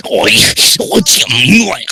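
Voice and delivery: loud, high pirate exclamation; pirate accent. Acted. Foxy the pirate fox to Alex, loud high pirate accent. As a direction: call out as a surprised pirate. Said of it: "In her pirate's accent".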